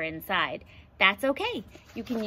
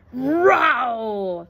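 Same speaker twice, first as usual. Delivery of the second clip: loud playful lion roar; ferocious roar; 2 semitones higher, 9 dB louder than usual. Acted. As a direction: roar big for the kids. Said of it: "I let out a ferocious roar".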